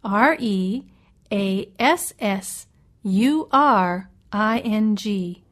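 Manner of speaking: slow, each letter separate; spelled letter by letter. Shown as a demonstration. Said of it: "Let me spell reassuring for you".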